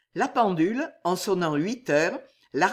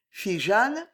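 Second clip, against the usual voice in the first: ordinary delivery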